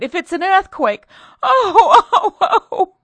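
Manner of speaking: very high, very loud wails; shrieking. Acted. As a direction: scream in pure terror. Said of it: "gave a loud shriek".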